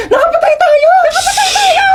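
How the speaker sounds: high, loud, laughing-crying shouts; rising hysterical wail; panicked wailing. Acted. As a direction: shriek in mock hysteria. Panic after hitting person.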